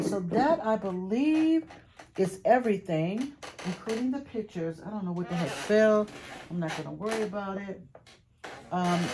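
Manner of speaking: usual speaking voice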